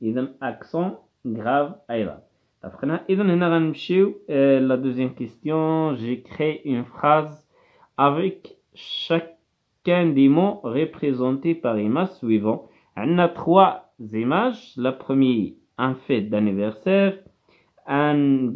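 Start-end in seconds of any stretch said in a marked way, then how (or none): 6.0-12.7 word by word for the class; careful teacher reading pace; slow dictation reading. Shown as a demonstration. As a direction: read the instructions very slowly. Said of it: "reading the instructions slowly".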